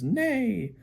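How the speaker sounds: high whinny sliding down; horse neigh. Acted. Parent showing the horse in the book, horse neigh. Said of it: "the horse that says".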